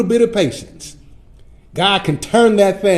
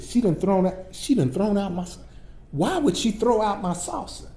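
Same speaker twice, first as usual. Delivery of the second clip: talking to myself; 3 semitones higher, 8 dB quieter than usual. Retold or imitated. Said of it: "So I started talking to myself".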